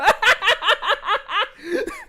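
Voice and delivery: rising squeal of a laugh; high teary laugh. Real, not acted. Streamer embarrassed at crying, high rising laugh. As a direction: giggle up through the tears.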